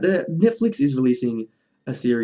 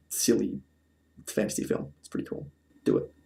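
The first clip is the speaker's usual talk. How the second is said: hushed conspiratorial whisper; 5 semitones lower, 10 dB quieter than usual. Pretend. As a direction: lean in and whisper the nudge. Whispered aside urging viewers.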